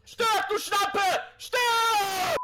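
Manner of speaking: yelling at the enemy, fierce; furious battle cry; shouting. Real, not acted. Gaming rage shouting at enemy. (wütender Schrei)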